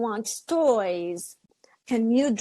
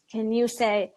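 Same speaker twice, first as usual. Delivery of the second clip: ordinary delivery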